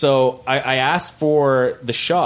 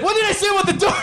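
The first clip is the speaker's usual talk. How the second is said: high, laughing mock scold of a mother; a mother's shrill-free rebuke, hammed up and giggling; mock scolding mom; much faster than usual. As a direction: play the exasperated mom through laughter. Mom scolding about the door.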